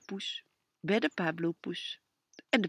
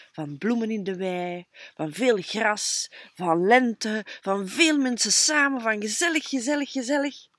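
louder, rising, delighted; excited; 4 semitones higher, 8 dB louder than usual. A narrator's reading. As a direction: build up joy at spring coming. Dreaming of spring, building. (steeds enthousiaster en luider)

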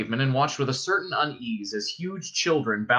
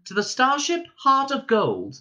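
high, composed welcome announcement; stewardess voice; 11 semitones higher than usual. A narrator's reading. Ship's voice welcoming rescued passengers, stewardess voice. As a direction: announce it like cabin crew.

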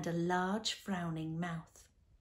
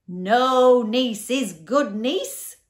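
very loud, slow roar, pitched up; 5 semitones higher, 14 dB louder, much slower than usual. A narrator's reading. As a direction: roar the punchline in huge drawn-out words. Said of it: "roared the Din again".

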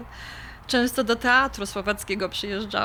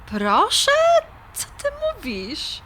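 surprised; 8 semitones higher than usual. Acted. Shocked at his sudden proposal.

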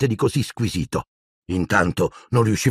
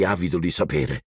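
ordinary delivery

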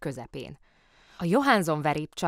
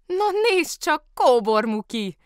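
bright, much higher happy shout; delighted high call; 12 semitones higher, 9 dB louder, slower than usual. A narrator's reading. Trumpeter calling out happily to Kóbor Muki. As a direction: greet an old friend with joy. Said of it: "kiáltotta ismét".

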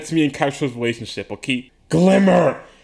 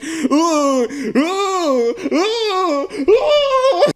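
hammed-up pained moans; 11 semitones higher, much slower than usual. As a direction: ham up each hit landing, rising each time. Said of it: "as I'm like".

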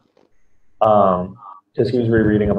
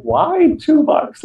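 incredulous; much slower than usual. Retold or imitated. Retelling a haggle, own incredulous quote.

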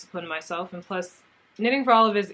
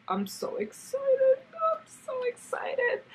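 much higher, slow squeaky I'm so excited; squealing excitement; slower than usual. Real, not acted. Vlogger showing her new mohair, high slow squeal.

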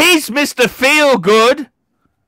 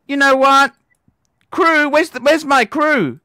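normal talking voice

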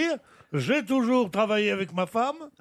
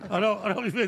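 ordinary delivery

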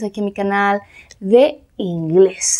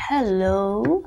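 "hello" slowly drawn out, rising at the end; careful, lengthened "hello" with a lifted ending; pronunciation demo; 2 semitones lower, 4 dB quieter than usual. Shown as a demonstration. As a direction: stretch "hello" for learners to copy. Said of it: "ahí tenemos la pronunciación".

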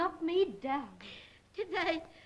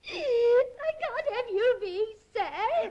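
slow, higher tearful wail; drawn-out, sorrowful plea; weepy protest; 4 semitones higher, 4 dB louder, much slower than usual. Acted. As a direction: wail it with motherly distress. Weeping mother protests at other's sadness.

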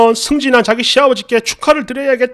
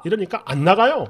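usual speaking voice

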